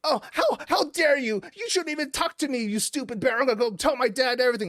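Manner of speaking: put-on very high, bratty child voice; high-pitched mock outrage; indignant kid. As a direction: play a spoiled kid in a huff. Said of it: "this kid's just like".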